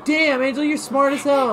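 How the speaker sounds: high, loud delighted praise; excited praise. Real, not acted. Player cheering a friend's clever escape, excited. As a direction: cheer her on, thrilled.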